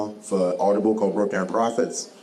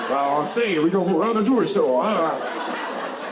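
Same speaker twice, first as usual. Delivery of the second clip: mock a narrator's fake Southern accent, laughing; overdone Southern accent; 8 semitones higher than usual. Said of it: "lean into this Southern accent".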